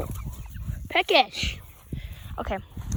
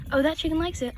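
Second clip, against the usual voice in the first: normal talking voice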